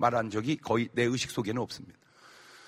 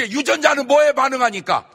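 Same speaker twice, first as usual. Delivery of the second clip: much higher and louder, shouting it out; loud question; 9 semitones higher, 10 dB louder than usual. Shown as a demonstration. Preacher shouts the question to the congregation.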